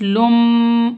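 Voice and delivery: लुम with a long humming m; ghunna. Shown as a demonstration. Teacher spelling out the syllable, nasal hum on लुम. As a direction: hold the m of लुम through the nose. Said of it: "गुन्ना इख्फा के साथ पढ़ेंगे".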